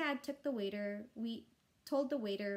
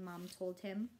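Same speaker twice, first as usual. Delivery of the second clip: usual speaking voice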